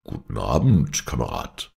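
low-pitched mock-male greeting; deep voice. A narrator's reading. Disguised heroine greeting her lover as comrade, deep voice. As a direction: drop the voice low for a comradely hello. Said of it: "tönte eine tiefe Stimme ihm entgegen".

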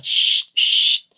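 whispered shushing; hushing shh. A narrator's reading. Children hushing one another like owls. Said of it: "siseos como lechuzas nuevas".

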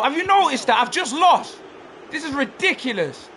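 real high, loud outrage; angry shouting. Real, not acted. Gamer protesting a loss to the screen, angry shouting. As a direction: shout in disbelief at the game. Gamer rages as match is lost.

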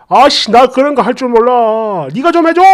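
dad whining to mom to do it for him; whiny complaint. Retold or imitated. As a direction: imitate a grown man whining like a child. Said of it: "이러면서 오지게 징징대니까".

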